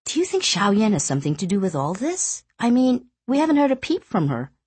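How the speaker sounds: put-on puzzled young wife, musing; young woman voice. Wife speaking to husband.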